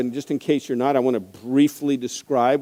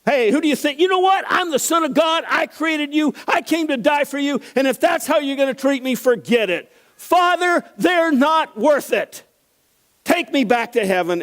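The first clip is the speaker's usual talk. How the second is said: indignant outburst; 13 semitones higher than usual. Pretend. Preacher imagining himself as Jesus, shouting at his tormentors. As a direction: blow up at them in outrage. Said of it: "that would have been it for me".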